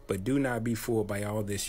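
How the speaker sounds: normal talking voice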